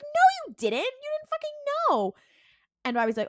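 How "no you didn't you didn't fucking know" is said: very high, loud mock yell; mock outraged shriek at his brother. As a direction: shriek it at him, hammed-up. Sam yelling at Dean.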